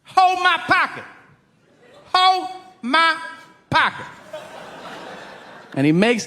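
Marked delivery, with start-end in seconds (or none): 0.0-1.0 high, strained tough-guy yell; bully a kid with a high, forceful order; hammed-up inmate command; intimidating prisoner. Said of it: "he goes". 2.1-4.1 high, strained order, word by word; hammed-up inmate demand with pauses; intimidating prisoner. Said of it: "he goes".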